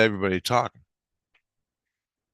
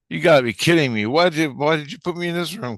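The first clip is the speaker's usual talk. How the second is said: high, louder outburst of disbelief; incredulous protest; 8 semitones higher, 4 dB louder than usual. Retold or imitated. Man questions hosts about his room, incredulous. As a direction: retell a can't-believe-it complaint.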